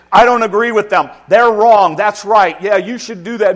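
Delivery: loud, high take-your-side voice; mock parent siding with kid. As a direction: egg the kid on against the teacher. Parent siding with complaining child.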